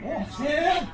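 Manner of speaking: high shout from outside the door; distant high shout; shouting names. Acted. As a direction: yell for the children from the street.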